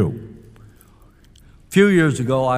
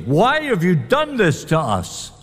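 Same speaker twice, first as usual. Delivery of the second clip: mother scolding, more annoyed now; mad mother; 6 semitones higher than usual. Retold or imitated. Mary scolds Jesus, mildly mad. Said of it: "she was mad".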